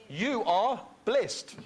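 slow emphatic blessing; slow declaration. Real, not acted. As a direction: proclaim it slowly over them.

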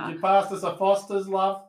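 put-on over-the-top Australian accent; fake Aussie accent. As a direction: lay the Aussie accent on thick for laughs. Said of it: "less likely to attack people with Australian accents".

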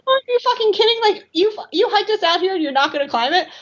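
high, outraged mock rant that settles back down; retold exasperated outburst at a friend who won't climb; hissy fit. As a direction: act out your disbelief at your friend, starting high. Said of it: "I'm throwing a little bit of a hissy fit".

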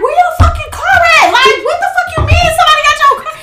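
incredulous yell. Retold or imitated. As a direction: yell at him in disbelief. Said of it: "I'm like".

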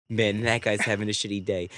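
low, soft sympathetic aside; low-key pity. Acted. Driver passing a crash, low-key pity.

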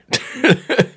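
loud high laugh; burst of laughter. Real, not acted. Laughing at studio.

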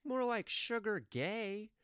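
high, drawn-out mocking kid; high-pitched heckle, stretched out; kid's taunt. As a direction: imitate a kid lobbing a slow insult. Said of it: "the kid just goes".

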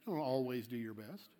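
low, soft, knowing correction; hushed, matter-of-fact rebuttal; quiet correction. Shown as a demonstration. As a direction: drop low and soft to set them straight.

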